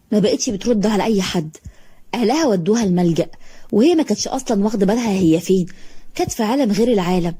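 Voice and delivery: usual speaking voice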